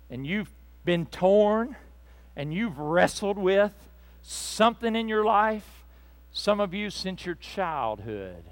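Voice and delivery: ordinary talk, nothing marked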